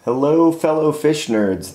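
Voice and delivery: usual speaking voice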